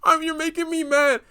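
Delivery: high mocking imitation of a sulky girl; mocking whiny voice. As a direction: parody someone sulking. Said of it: "I hate when people do this".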